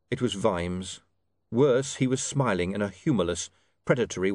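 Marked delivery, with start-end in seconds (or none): none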